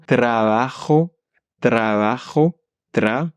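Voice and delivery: "Trabajo" slow with a rolled r. Shown as a demonstration. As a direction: trill the r in "Trabajo". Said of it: "quelques mots pour t'exercer".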